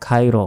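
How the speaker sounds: "খাইর" with a plain kh and an open "ai"; ভুল উচ্চারণ. Shown as a demonstration. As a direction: say "খাইর" with flat Bengali kha, as many wrongly do. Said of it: "অনেকে বলি". ("খাইর" হালকা খ দিয়ে ভুল উচ্চারণে)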